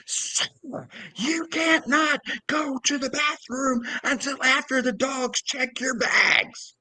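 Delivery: high-pitched, drawn-out imitation of a yelling employee; squawked mock yell, slow and high. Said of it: "Like, literally yelling at me".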